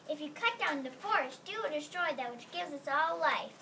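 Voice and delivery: plain reading voice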